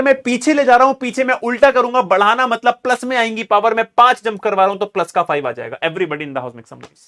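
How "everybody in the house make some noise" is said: quiet throwaway. Acted. Same call, tossed off quietly.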